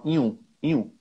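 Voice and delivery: "inho" with the nh dropped, a nasal "ĩu"; Bahian diminutive. Shown as a demonstration. Teacher showing the Bahian diminutive, "inho" as nasal "ĩu". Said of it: "Eles não falam inho, eles falam".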